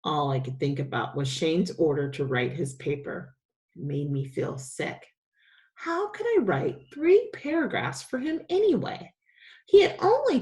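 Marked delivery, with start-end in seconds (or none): none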